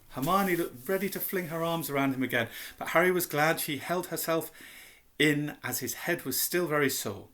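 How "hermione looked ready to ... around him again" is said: ordinary delivery, nothing marked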